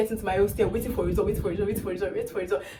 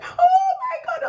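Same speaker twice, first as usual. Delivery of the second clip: very high, loud, laughing shriek; excited scream; 5 dB louder, slower than usual. Retold or imitated. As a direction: shriek it like the roommate did. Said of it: "She was like".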